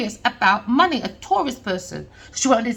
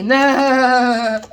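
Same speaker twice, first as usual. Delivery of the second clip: laughing no; 7 dB louder than usual. Real, not acted. Laughing refusal of kindness.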